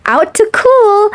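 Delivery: ordinary delivery, nothing marked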